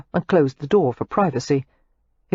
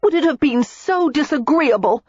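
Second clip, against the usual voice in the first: Daisy ranting; 10 semitones higher than usual. A narrator's reading. Daisy rants at Matthew, hurt and indignant. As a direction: rant at him, hurt and indignant. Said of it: "Daisy was ranting".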